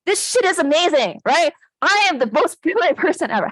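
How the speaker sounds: hammed-up self-hype for the audience; pumped up. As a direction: sell it like you're the best. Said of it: "pump yourself up a little bit".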